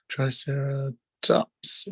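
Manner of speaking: ordinary talk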